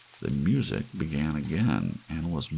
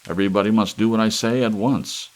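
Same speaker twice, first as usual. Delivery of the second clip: loud, higher imperious orders; commanding; 4 semitones higher, 8 dB louder, faster than usual. Acted. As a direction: demand obedience from the crowd. Harrison commands the studio.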